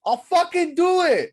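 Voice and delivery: high, loud, slow hammed-up threat; mock threat. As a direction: yell it like a cartoon villain. Threatening to break figure.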